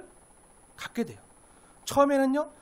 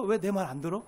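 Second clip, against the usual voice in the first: ordinary talk, nothing marked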